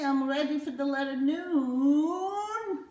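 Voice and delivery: high, slow squeaky puppet voice; a cartoon letter character announcing itself. Puppet letter greeting the kids, high and slow.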